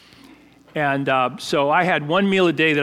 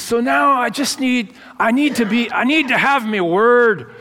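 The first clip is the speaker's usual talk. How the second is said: higher, lightly imitated Edinburgh brogue; Scottish brogue; 7 semitones higher, 5 dB louder than usual. Head dishwasher retells young Scottish teammate dodging dishes, imitated Edinburgh brogue. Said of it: "that thick, you know, Edinburgh brogue".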